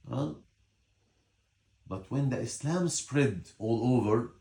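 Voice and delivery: usual speaking voice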